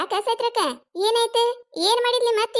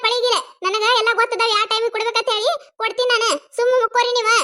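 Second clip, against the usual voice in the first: high, raised voice of a fed-up daughter-in-law; pitched-up, insistent telling-off; exasperated scolding; 5 semitones higher, 4 dB louder than usual. Acted. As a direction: scold the nagging mother-in-law into silence. Daughter-in-law scolds nagging mother-in-law.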